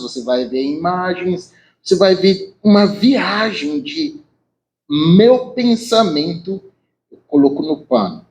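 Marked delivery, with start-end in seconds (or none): none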